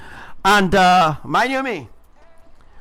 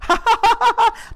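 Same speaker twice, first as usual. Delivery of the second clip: imitating a high, wild cackle of laughter; hysterical laugh. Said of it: "Julio was laughing hysterically".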